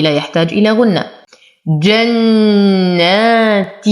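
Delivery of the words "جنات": slow جنات with a full nasal ghunna; recited with ghunna. Shown as a demonstration. Teacher models full ghunna. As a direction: hold the doubled nun of جنات. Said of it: "نشد النون بغنة".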